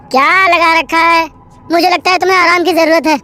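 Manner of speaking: exasperated. Acted. Suresh exasperated.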